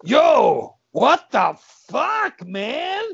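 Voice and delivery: high, loud, drawn-out disbelief; hammed-up aghast yell; appalled outburst. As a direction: shout in shocked disgust. Companion appalled by the leprechaun.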